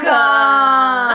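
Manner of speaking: loud curse. Acted. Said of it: "I cursed again".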